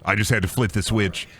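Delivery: usual speaking voice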